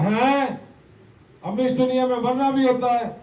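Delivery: put-on a small boy shocked to learn of death; child stunned that people die; surprised child. Child asks mother about death.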